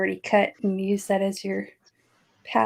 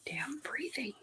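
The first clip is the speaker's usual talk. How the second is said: breathy whispered grumble; whispering; 4 semitones higher, 17 dB quieter than usual. Real, not acted. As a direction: whisper it under your breath. Grumbles about her hiccups.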